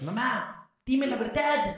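high mock-dramatic child demanding the truth; niño suplicando a mamá. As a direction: plead with mum in a hammed-up high voice. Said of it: "le decías". (niño dramático suplicando, voz muy aguda)

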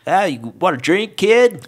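high, loud hammed-up barkeep; gruff bartender voice. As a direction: play the bartender, big and high.

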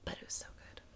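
breathy gushing whisper. Real, not acted. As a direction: whisper it like a secret. Hushed gushing about the show.

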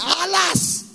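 high, loud wail of despair; a servant crying out in terror, high and loud; terrified wail. Acted.

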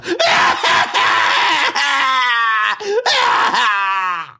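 hammed-up wail with no tears, just noise; mock dry wailing. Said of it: "就是干嚎穷喊呐". (假装干嚎，大声哭喊却没眼泪)

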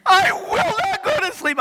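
high, mimicking the kid's refusal; dad imitating his daughter in a high voice; mocking child voice. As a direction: parrot her defiance back. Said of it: "And I said".

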